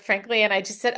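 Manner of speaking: normal talking voice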